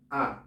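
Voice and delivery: "A" said as an open Spanish ah, low and drawn out; demonstrated vowel A. Shown as a demonstration. Teacher modelling the German vowel "A", open ah. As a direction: model the vowel "A" cleanly for students. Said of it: "que se pronuncia igual que en español".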